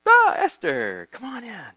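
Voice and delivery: slow, drawn-out regal greeting; a king graciously waving her in; stretched-out royal welcome; welcoming king. Retold or imitated.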